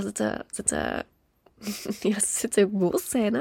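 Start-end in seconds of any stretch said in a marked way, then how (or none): none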